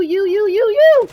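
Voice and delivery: mock their repeated word, building to a loud high peak; escalating parody of someone's pet word; mocking repetition. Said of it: "without saying the word you".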